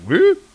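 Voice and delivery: one very high strangled whoop; mouthless squeak. Acted. Vala, her mouth gone, gives a very high muffled whoop. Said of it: "and she's like".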